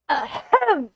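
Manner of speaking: loud, exaggerated ahem; throat clear. Lawyer trying to get the old man to notice him, loud mock ahem. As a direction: clear your throat loudly for attention. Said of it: "clear my throat".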